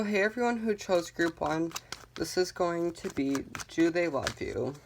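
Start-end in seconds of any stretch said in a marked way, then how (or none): none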